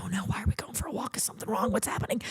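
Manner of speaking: whispered, anxious and rushed; panicked whisper. Retold or imitated. Friend asks for a walk, anxious fast whisper. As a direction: hiss it out in a fast nervous whisper.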